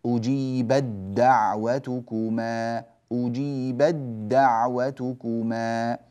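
slow "أجيبت دعوتكما" with the ta merged into a doubled "د"; slow Quranic recitation, "أجيبت" flowing into "دعوتكما"; recited assimilation. Shown as a demonstration. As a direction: chant "أجيبدّعوتكما" slowly. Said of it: "ندغم التاء في الدال".

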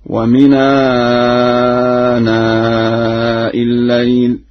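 slow chant, "ومن آناء" linked as wa-minaanaa; Warsh vowel transfer. Shown as a demonstration. Teacher gives an example of Warsh, "ومن آناء" chanted wa-minaanaa. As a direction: glide "ومن آناء" together without a glottal stop, long vowels. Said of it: "ورشا ينقل الهمز إلى الساكن قبله".

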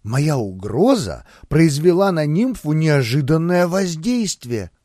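usual speaking voice

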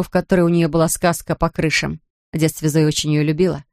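ordinary delivery

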